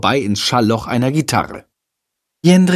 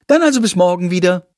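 higher-pitched huffy reply; snippy; 6 semitones higher than usual. A narrator's reading. Lilly brushing off his excuse, snippy. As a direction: answer in a snippy tone. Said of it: "entgegnet sie zickig".

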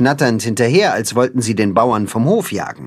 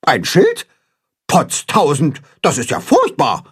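a higher upset goose cackle; flustered goose squawking alarm; mild hammed-up goose panic; agitated goose; 4 semitones higher than usual. A narrator's reading. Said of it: "schnattert Grimm aufgewühlt".

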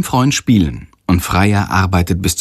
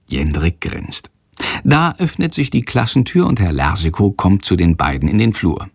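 ordinary delivery

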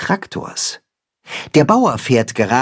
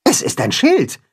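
higher, light character voice; Timme, explaining; 9 semitones higher than usual. A narrator's reading. Timme tells mole Max what the board is, explaining. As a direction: answer up high, matter-of-fact. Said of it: "sagt Timme".

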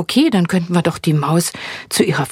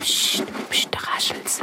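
urgent hushing whisper; 10 dB quieter than usual. Acted. Yvonne warns Ulk that the mouse is coming, whispering. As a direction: shush everyone, then whisper the news. Hiding, hearing the mouse rustle.

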